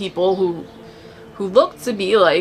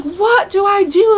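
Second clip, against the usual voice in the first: high, louder, slow frightened cry; panicked question; 12 semitones higher, 5 dB louder than usual. Retold or imitated. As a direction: ask in panic, voice rising high. Fleeing the demon in dream.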